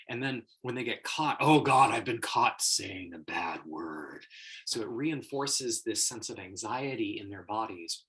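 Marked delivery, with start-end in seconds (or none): 1.4-4.2 loud outburst sinking into slow, lower dread; mock panic. Kid caught saying a bad word, mock panic. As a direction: blurt it, then drag out the guilt.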